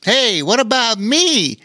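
high, put-on girl's voice; storybook girl. A narrator's reading. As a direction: pipe up so as not to be left out. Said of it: "said another girl".

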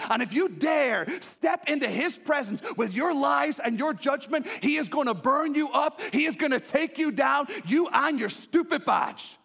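high, loud defiant warning; thundering pulpit challenge; fierce preaching. Acted. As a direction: hurl it at the accuser.

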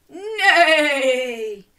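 high, loud falling horse whinny; horse neigh. Acted. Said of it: "See if you can join in".

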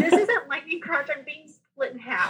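high-pitched, laughing protest; retold protest. Patient objects to doctor's lightning crotch remark, high laughing protest.

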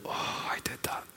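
awed whisper at the cross; hushed, voiceless confession. Retold or imitated. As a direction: breathe it out in hushed guilt. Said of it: "stare at Jesus on the cross and go".

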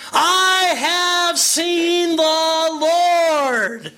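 slow, high, tearful and laughing proclamation; with conviction. Acted. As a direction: proclaim it with overflowing joy, every word drawn out. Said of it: "She said with conviction".

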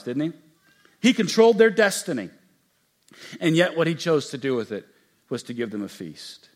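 ordinary talk

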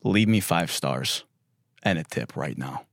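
low, quiet threat fading almost to a hush; menacing driver demand. Retold or imitated. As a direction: growl it low like you hold a gun. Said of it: "He's like".

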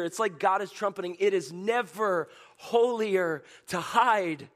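high, loud, slow sermon climax; fiery preacher proclaiming God's call; preaching at full force. Acted. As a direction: trumpet it to the whole congregation.

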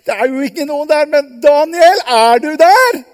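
high, loud call; anxious calling. Acted. The king calls into the den, loud and anxious. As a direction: shout down, hoping for an answer. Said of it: "Så roper han".

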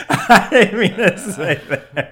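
laughing. Real, not acted. Laughing at his own slip.

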